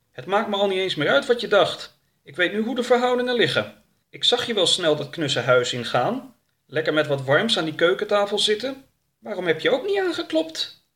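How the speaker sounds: high, reproachful creature voice; huffy and high; indignant Wubbel. Acted. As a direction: scold your companion, voice pitched well up.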